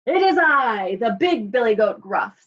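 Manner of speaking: booming big billy goat; loud as the troll. A narrator's reading. Big goat answering the troll, loud bold voice. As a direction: announce yourself loudly as the big goat. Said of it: "as loud as the troll's".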